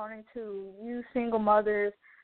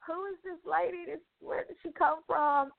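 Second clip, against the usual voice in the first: super shocked; 7 semitones higher than usual. Retold or imitated. Wondering about the stranger paying her bill, super shocked. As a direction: recall your bewilderment, pitched up and hushed a little. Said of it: "I'm, like, super shocked".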